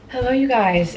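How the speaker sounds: bright, lively hello to viewers; upbeat greeting. Real, not acted. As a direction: greet the camera with energy. Energetic evening vlog greeting.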